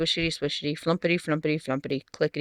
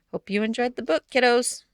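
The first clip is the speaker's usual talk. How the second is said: plain reading voice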